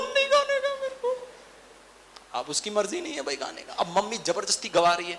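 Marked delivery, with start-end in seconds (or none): 0.0-1.3 put-on a small child digging in his heels; stubborn little boy. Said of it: "बोला ना". (ज़िद्दी छोटे बच्चे की ऊँची आवाज़)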